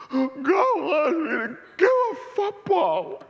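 high, loud, slow wailing sob; retold crying. Retelling telling his friend God's call, crying and high. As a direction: wail it like a broken-hearted kid. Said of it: "Had me all crying and weeping".

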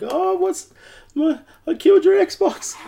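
very high mock-tearful confession; hammed-up imitation of a panicked partner; freaking-out partner. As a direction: blurt it in a distraught falsetto. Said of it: "and she's freaking out".

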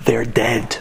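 hushed, low near-whisper; confidential aside. Retold or imitated. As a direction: let it drop low, as if sharing a secret. Confiding to passenger, between me and you.

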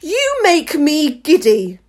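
high, loud, drawn-out complaint; slow royal scream; screaming queen. A narrator's reading. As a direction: screech it slowly as an outraged queen. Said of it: "screamed the queen".

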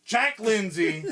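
slow, loud name drop with a laugh; excited reveal. Acted. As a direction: announce him like a hero's entrance. Big reveal of the pilot's name.

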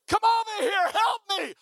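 high, loud cry for help; screaming at the top. Retold or imitated. Boy grabbed by dog begs friend, high frantic scream. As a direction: scream desperately for rescue, hammed-up. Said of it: "I'm screaming at the top".

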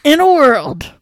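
loud dramatic movie trailer opening. Acted. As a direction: boom it like the trailer announcer. Parody of trailer voice.

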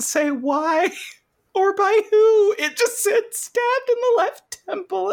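climbing into a very high, strained squeak; exasperated squeal. Real, not acted. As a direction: squeal it in disbelief and frustration. Exasperated at missing explanation.

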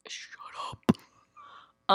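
breathy hushed shut up; whispered shut up. Pretend. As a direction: whisper it teasingly. Whispered mock shush.

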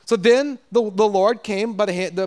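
usual speaking voice

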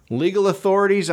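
normal talking voice

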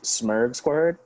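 "Smurg Squared" said as smurg with an ur vowel, then squared; playful corrected pronunciation "Smurg Squared"; pronounced Smurg. Shown as a demonstration. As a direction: say "Smurg Squared", not Smog Squad. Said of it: "it's actually pronounced".

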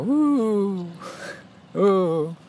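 high hooting coo, like a dove; mourning dove imitation. Imitating the doves heard in the morning, high cooing. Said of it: "but they go".

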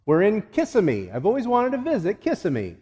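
KISSIMMEE with the stress on the first syllable, KISS-a-mee; mispronounced Kissimmee. Acted. Excited friend on a Florida car trip, mispronouncing Kissimmee. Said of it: "it's actually pronounced Kissimmee".

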